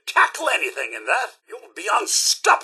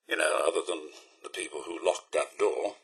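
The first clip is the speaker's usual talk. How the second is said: dry aside; 9 dB quieter than usual. Acted. Dry aside after lockpicking.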